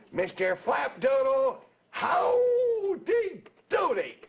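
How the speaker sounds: very high, slow, drawn-out loud call. Acted. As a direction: stretch the magic words out into the microphone. Calling into the magic microphone.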